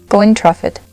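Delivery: usual speaking voice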